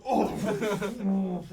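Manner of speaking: surprised gasp. Acted. GM voicing NPC's reaction.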